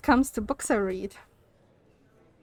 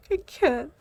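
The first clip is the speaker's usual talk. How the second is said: laughing; 10 semitones higher than usual. Real, not acted. Laughing at the bad book.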